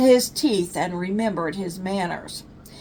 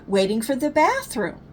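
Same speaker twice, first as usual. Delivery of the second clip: ordinary delivery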